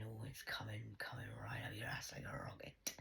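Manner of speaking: soft, breathy menacing whisper; ominous whisper. Real, not acted. Woman whispering a threat about coming judgement. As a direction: whisper it like a dark promise.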